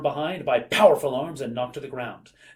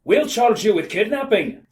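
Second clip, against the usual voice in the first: snapped, loud and higher; slow, forceful acted threat; Chief Collig; 7 semitones higher, 7 dB louder than usual. A narrator's reading. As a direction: snap it like a police chief. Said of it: "snapped Chief Collig".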